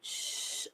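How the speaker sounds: long, soft whispered shush; breath-only "shhh", drawn out; hushed shh in the ear. Retold or imitated. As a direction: imitate the ghostly shushing. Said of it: "se escucha otra vez como me dicen".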